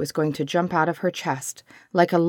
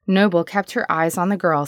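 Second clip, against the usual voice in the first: usual speaking voice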